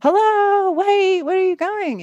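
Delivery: high, loud cry after them; panicked call. Retold or imitated. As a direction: call out in alarm as they leave. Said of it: "And she's going".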